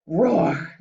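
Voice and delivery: loud roaring dragon cry; dragon bellow. Acted. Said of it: "bellowed the dragon".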